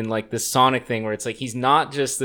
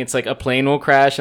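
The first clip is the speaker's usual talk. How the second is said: ordinary talk, nothing marked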